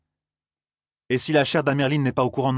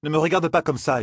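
ordinary delivery, nothing marked

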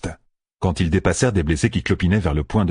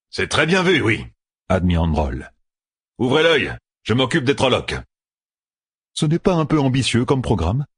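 higher, lightly voiced exchange; character dialogue; 5 semitones higher than usual. A narrator's reading. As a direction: banter under fire.

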